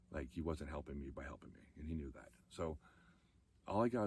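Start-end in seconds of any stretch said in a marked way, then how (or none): none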